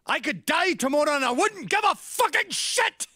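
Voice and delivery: very high, very loud furious shout; imitated yell. Dad mad at son for grieving, yelled in imitation. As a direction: scream it as the outraged father. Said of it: "he yelled at me".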